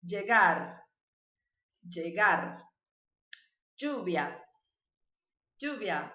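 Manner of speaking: ll said as a hard j in "Llegar", "Lluvia", "Llorar", "Calle", "Llama"; isolated model words, "Llama" as "djama"; Colombian pronunciation. Shown as a demonstration. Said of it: "na Colômbia eles vão falar".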